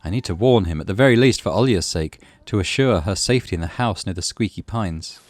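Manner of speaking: ordinary talk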